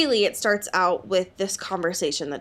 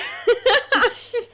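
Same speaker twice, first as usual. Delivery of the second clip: high laugh; 12 semitones higher, 3 dB louder than usual. Real, not acted. Laughing at the dad's line.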